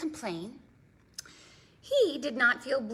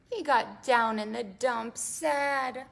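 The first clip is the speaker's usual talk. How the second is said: ordinary delivery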